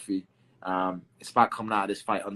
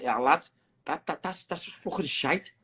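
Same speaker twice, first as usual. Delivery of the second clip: mock Scouse critic; 4 semitones higher, slower than usual. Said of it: "Other people say that".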